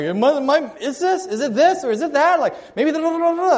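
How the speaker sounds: high, eager, rambling guesses; hammed-up know-it-all students; mocking eager students. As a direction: send up the students' jargon.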